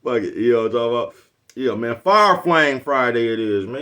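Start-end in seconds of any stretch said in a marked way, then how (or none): 2.0-3.6 slow, loud, drawn-out announcement; slow hype call of the catchphrase. Real, not acted. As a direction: belt it out like a radio drop. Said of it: "announcing catchphrase".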